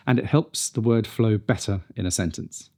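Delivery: ordinary delivery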